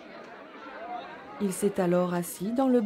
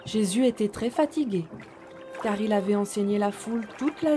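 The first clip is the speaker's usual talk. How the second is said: soft storytelling voice; 4 dB quieter than usual. A narrator's reading. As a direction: read gently to little children. Story narration.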